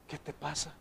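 hushed question, as a father would ask; very soft imagined father's voice; soft fatherly reproach. Acted. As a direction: drop the voice right down. Imagines father gently questioning him.

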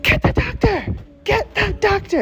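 very high, strained mock scream; high-pitched retold shriek. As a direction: scream for help without real volume.

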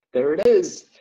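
excited exclaim. Retold or imitated. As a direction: shout it out with relief. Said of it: "we're like".

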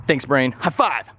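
usual speaking voice

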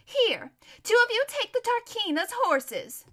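high, loud order to servants; commanding. Acted. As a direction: call out orders imperiously. Lady orders her slaves.

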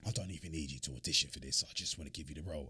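mock Idris Elba's smooth London greeting; low, soft London accent impression. Quoting Idris again.

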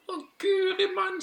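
very high, loud little bird; dismayed tiny bird voice; sparrow. Acted. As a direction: squeak in alarm like a sparrow. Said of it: "sa spurven".

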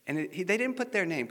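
ordinary talk

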